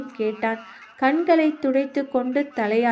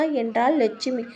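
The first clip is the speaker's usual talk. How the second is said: ordinary delivery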